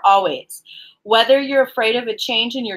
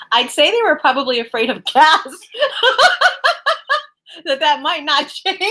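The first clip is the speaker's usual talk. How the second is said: real giggling; 5 semitones higher than usual. Real, not acted. Card reader joking about fear of gas, laughing helplessly. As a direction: joke dissolving into high laughter. Cracks up at own gas joke.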